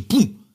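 high, loud punched "boom"; explosive boom. Shown as a demonstration. Pundit shows how fast the team breaks forward, explosive boom. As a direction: burst out with a sudden boom. Imitates sudden burst of a transition.

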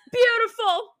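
high teary mock sobbing praise; imitating a weeping mom, high-pitched. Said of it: "And she goes".

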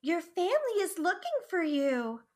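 high, lilting character voice; Chica. Acted. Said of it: "now do the Chica line".

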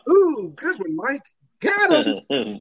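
high, sarcastic cheer; a sarcastic high-pitched "good one"; mock sarcastic praise. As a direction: cheer him on with fake delight. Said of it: "And I was like".

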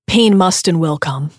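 ordinary delivery

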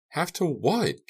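contained, high angry cry; angry scream. A narrator's reading. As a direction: throw the question up high in anger. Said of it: "Carrie screamed angrily".

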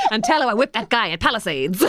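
laughing, high gangster bark; gangster shout. Acted. Podcaster voicing Bruce as a gangster, laughing high shout. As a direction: shout it like a cocky old-timey gangster. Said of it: "one of his amazing gangster lines".

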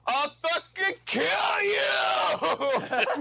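high, drawn-out yell imitating a raging threat; hammed-up scream breaking into laughter; mock shout. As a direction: bellow it skyward, stretching the words. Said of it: "he shouts up to the sky".

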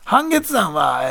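slow drawn-out fighter voice; game character voice. Acted. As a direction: call it like a fighting game move. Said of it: "なんでそんな声してる？".

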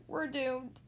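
ordinary delivery

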